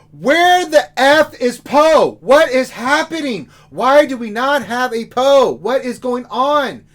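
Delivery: loud, high, slow indignant shouting; exasperated outburst. Real, not acted. Ranting to co-hosts about missing Poe, loud exasperated shouting. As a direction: wail it out as if the injustice is unbearable.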